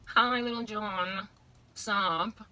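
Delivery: put-on slow, sweet schoolgirl greeting a boy; coy little-girl hello, drawn out; girly playground hello.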